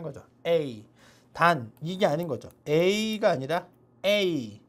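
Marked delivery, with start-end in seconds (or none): 2.7-4.6 A drawn out very slowly, mouth opening on the second; slow contrast of vowels. Shown as a demonstration. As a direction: contrast the two A sounds, slowly. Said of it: "좀 더 입을 벌리고 A". (A를 아주 천천히 대비해서)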